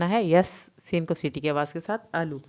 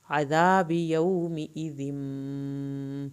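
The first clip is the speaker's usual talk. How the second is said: slow recitation; much slower than usual. Shown as a demonstration. Teacher recites verses to students, slow chant. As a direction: recite slowly, stretching the vowels. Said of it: "یہاں پہ ایک الف کے برابر دیر لگے گی".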